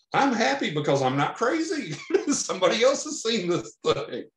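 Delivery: high voice breaking into laughter; laughing with relief. Retold or imitated. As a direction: laugh it out, relieved you're sane. Relief at not being crazy.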